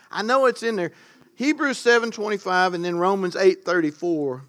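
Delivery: normal talking voice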